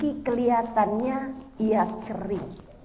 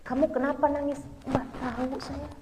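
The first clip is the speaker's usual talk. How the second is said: subdued, soft mock-sad reply; woman secretly crying is asked why, quietly sad; quietly tearful; 9 dB quieter than usual. Let it fade softly, as if holding back tears. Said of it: "yang diem-diem nangis".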